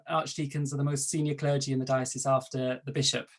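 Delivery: ordinary delivery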